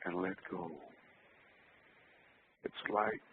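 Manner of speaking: soft, hushed meditation voice; soft spa whisper. Acted. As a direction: lull them into calm, quietly. Leads a relaxation meditation.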